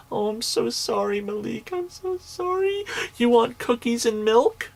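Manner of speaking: put-on sarcastic high-pitched babying; mock sympathy. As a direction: coo over him like a poor little baby. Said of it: "look at him and say".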